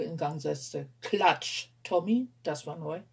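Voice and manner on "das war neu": usual speaking voice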